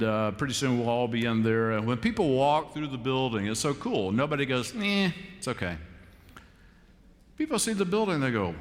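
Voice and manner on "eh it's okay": tag withheld. Acted. Imagined unimpressed building visitor.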